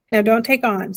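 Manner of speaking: plain reading voice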